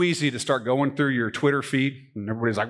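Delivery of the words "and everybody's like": normal talking voice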